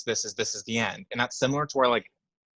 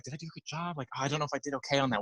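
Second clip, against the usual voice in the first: quiet; 8 dB quieter, faster than usual. Retold or imitated. Retold insecure character quote.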